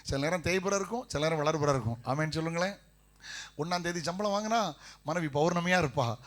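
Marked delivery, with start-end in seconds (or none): none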